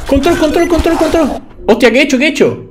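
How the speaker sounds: high-pitched frantic babble; panic. Real, not acted. Player about to be killed hits wrong key, panicking. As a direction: panic as the attack lands.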